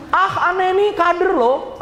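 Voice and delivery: high, slow, sneering mock voice; snide customer. Customer sneering at the party member, high slow mock. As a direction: drag it out, mocking the member. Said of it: "Aneh ngomong gitu".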